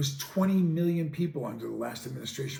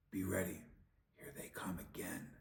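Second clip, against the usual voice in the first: sarcastic, softer spooky whisper; mock spooky whisper; 7 semitones lower, 17 dB quieter than usual. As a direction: pretend to scare them, dripping sarcasm. Mocking scare whisper.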